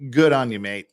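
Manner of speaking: normal talking voice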